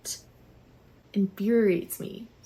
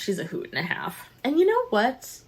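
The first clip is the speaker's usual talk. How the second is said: ordinary talk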